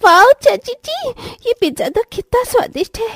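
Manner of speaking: put-on high, thrilled child's wow; excited kid. Kid delighted by pizza.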